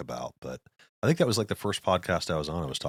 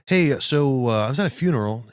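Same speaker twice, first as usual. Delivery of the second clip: imitating the neighbor, louder and urgent; 7 semitones higher, 7 dB louder than usual. Voicing the neighbor warning about the funeral, louder.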